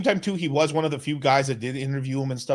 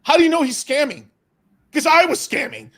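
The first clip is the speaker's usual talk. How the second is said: high, hammed-up brash tycoon; playful mock of a boastful billionaire; Donald Trump voice; 13 semitones higher, 6 dB louder than usual. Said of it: "he's gotta do the Donald Trump move".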